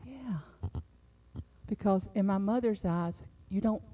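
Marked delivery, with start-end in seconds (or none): 0.1-0.4 unvoiced, hushed aside; whispered thought. Real, not acted. Preacher whispering her own thought to the congregation. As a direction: breathe it as a private aside.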